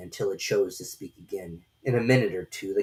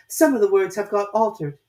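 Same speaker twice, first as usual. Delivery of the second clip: put-on high, light young girl voice; a polite little girl, voiced high; Alice's girlish voice; 11 semitones higher, 6 dB louder than usual. A narrator's reading. Alice answering the caterpillar in a high girlish voice.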